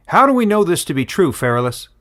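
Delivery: high, loud, fast shout from the crowd; shouted challenge. A narrator's reading. Wood collector shouting his doubt at the elder, high and loud. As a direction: call out in doubt across the crowd.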